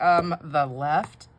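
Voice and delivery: slow, drawn-out, doubtful answer; mock unsure husband. Teasing husband's answer, slow mock puzzled. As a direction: drag it out like he can't believe she asks. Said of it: "No, he didn't say it like that".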